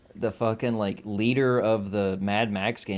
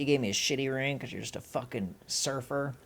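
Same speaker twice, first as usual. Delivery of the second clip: mock mean girlfriend; 4 semitones higher, 6 dB quieter than usual.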